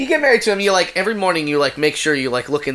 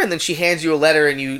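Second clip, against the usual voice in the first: ordinary delivery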